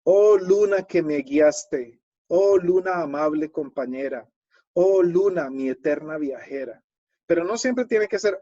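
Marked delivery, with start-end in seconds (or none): 0.0-6.8 poem recital. Shown as a demonstration. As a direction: recite it like a poet on stage. Reciting a sample poem aloud.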